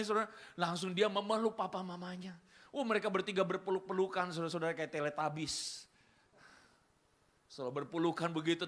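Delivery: ordinary talk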